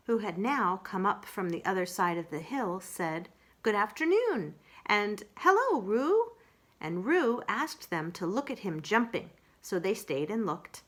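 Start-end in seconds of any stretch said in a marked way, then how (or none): 3.6-6.3 higher, louder cheerful hello; cheery greeting. Acted. Rabbit and Piglet greet Kanga and Roo, higher. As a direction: call hello brightly to the little one.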